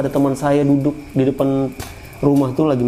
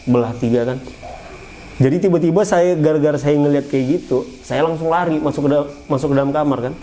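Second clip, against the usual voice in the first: ordinary talk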